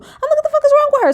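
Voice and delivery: incredulous. Retold or imitated. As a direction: react like you can't believe your eyes. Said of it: "I'm like".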